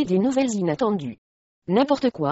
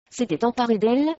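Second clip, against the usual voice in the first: ordinary delivery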